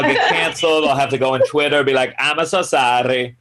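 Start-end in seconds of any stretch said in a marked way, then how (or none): 2.2-3.4 mock apology in an Italian accent. Canceled comic apologizing on Twitter, mock Italian accent. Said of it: "gonna do that accent".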